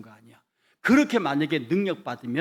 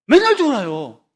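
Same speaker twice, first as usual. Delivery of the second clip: loud exclamation; 7 semitones higher, 14 dB louder than usual. Real, not acted. Preacher exclaims.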